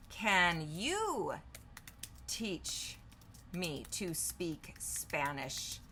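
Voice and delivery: slow, drawn-out words; spaced out at typing speed; dictating while typing. Real, not acted. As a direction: say it slowly as you type it. Typing a question while saying it.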